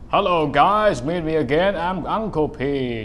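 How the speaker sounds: loud put-on YouTuber greeting; big booming channel intro. Shown as a demonstration. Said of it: "I can change to deep voice".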